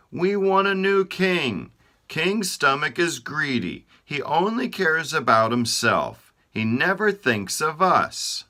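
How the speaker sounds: high, loud urgent plea; body parts petitioning. Acted. Body parts demanding a new king from God, high and urgent.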